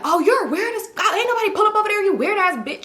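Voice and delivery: high-pitched mock tirade; imitated cussing out. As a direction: act out a friend cussing a man out. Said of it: "She cussing him out".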